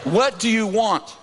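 gruff challenge. Retold or imitated. Reluctant husband challenging the preacher from far off, slow gruff demand. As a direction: draw out the irritated demand. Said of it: "Like that".

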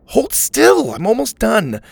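high, firm impatience; impatient scolding. A narrator's reading. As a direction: tell him off while bandaging. Scolding while bandaging.

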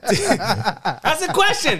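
high, slow laughing line. Real, not acted. Man laughing at his own question to friends, laughing. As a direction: laugh through it.

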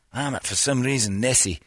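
ordinary talk

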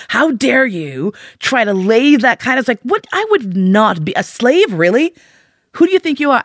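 high-pitched acted outrage; incredulous retold tirade; indignant. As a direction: rear up offended, pitch climbing to the final question. Acts outraged thought at slave remark.

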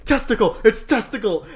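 high, loud indignant yell; old lady yelling. Retold or imitated. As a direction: yell it like a scandalized old teacher. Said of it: "imagine an old lady yelling".

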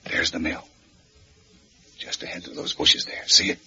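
soft whisper of warning. Acted. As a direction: keep it down, they're close. Sneaking up on the mill.

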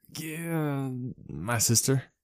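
lame answer. A narrator's reading. Max admits to Sho his sister did it, lamely. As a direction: trail off, embarrassed. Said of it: "he finally answered lamely".

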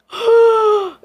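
loud, high gasp as if suddenly dropped; startled gasp. Retold or imitated. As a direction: gasp like the floor just fell away. Acts out the ride's sudden drop.

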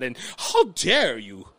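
slow, low-ending British put-on; amateur British indignation; mock British accent. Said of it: "With my British accent".

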